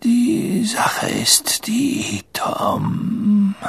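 high, slow, breath-laden sigh; sighing old headmaster. Acted. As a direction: sigh it out feebly, stretching the words. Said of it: "seufzte er". (seufzende, schwache Greisenstimme)

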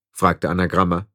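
ordinary delivery

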